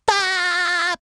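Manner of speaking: high, held "baah" sign-off; drawn-out high yelp; sustained yelp. Acted. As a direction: let out one long high cartoon cry. Character's sign-off noise.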